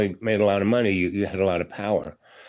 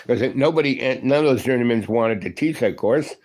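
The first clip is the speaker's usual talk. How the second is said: usual speaking voice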